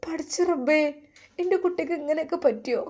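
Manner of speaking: anguished mother. Acted. A mother hearing her son was beaten, crying out high and fast. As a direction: call on God, rushing the words.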